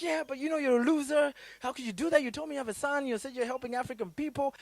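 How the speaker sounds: high, loud scolding friend voice; a lightly voiced friend telling him off. The friend scolds him for failing his son, loud and high. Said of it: "It's like".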